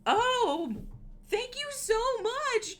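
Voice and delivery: very high, loud squealing thanks; overjoyed squeal. Real, not acted. Streamer thanking a donor live, squealing with joy. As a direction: shriek with delighted disbelief. Streamer gets huge donation.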